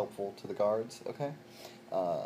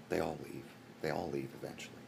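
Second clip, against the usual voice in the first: mock sorrow; 5 semitones lower, 13 dB quieter than usual. Mock sorrow, everyone leaves.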